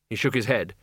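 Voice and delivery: plain reading voice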